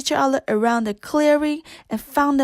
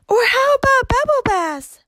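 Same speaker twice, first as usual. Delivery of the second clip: put-on much higher, bright child-like chirp; chirpy little teacup; 12 semitones higher, 4 dB louder than usual. A narrator's reading. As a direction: pipe up like an eager little cup. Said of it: "Chip chimed in".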